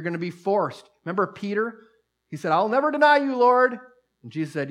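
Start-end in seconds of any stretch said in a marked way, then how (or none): 2.5-3.8 Peter's vow. Retold or imitated. Peter vows to Jesus.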